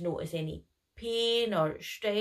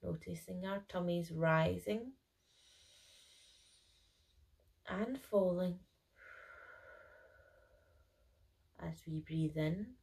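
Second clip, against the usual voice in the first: guided meditation; 8 dB quieter than usual. Acted. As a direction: gently guide each breath in and out. Leads a breathing meditation.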